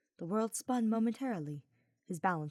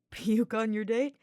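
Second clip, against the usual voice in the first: lightly voiced teasing knight; a hint of a cheeky young knight, grinning; Sylvain's voice; 4 semitones higher, 8 dB louder than usual. Sylvain teasing Hubert while carrying him, lightly voiced.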